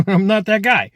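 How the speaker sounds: laugh, then punch it up in amusement; amused refusal. Acted. Repeats the refusal after laughing.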